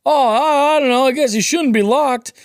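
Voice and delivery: high-pitched mock bewildered bystander; puzzled stranger. Voicing puzzled stranger outside door.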